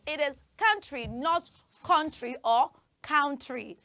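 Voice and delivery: "country" as "kun-tri", not "kaun-tri"; teacher contrasting pronunciations; correct versus wrong. Shown as a demonstration. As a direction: contrast the right and wrong vowel. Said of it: "say country".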